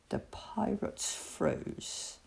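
ordinary delivery, nothing marked

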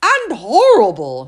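voiced child, insistent; child's voice.

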